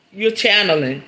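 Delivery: ordinary talk, nothing marked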